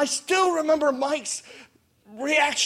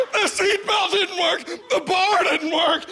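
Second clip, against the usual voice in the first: panicked cry; 8 semitones higher than usual. Retold or imitated. Teen on the coaster panicking about the broken bar, crying out. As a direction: wail it like the ride is about to drop.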